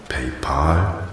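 "PayPal" tried with an open "-pahl" ending; trying pronunciations. Shown as a demonstration. Call-taker unsure how the name is pronounced, trying "PayPal" as "pay-pahl". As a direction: softly test "PayPal" as "pay-pahl", questioning. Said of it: "wie man es richtig ausspricht".

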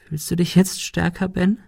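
hoch und leiser, höhnisch nachbohrend; leiser Spott. A narrator's reading. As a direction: leise stichelnd fragen. Monika höhnt leise. (Monika fragt Ben leise höhnisch)

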